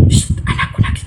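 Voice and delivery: soft breathy mother whisper, fast; hushed mock mother whisper. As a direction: whisper so the baby sleeps. Mother whispers over baby.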